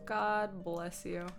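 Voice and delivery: ordinary talk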